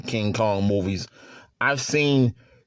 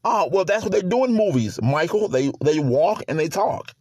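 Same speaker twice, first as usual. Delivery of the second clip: higher-pitched imitation of objecting friends; protesting buddies; 7 semitones higher than usual. Buddies answering his complaint about movies, higher-pitched mock protest. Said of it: "And people go".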